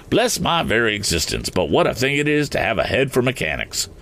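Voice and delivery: ordinary delivery, nothing marked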